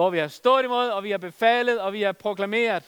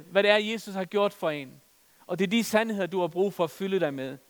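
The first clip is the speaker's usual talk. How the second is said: ordinary talk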